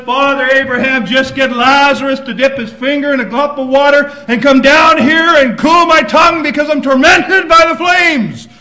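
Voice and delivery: loud, pleading cry from torment; desperate plea. Retold or imitated. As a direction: beg Father Abraham for relief. Rich man pleading from torment.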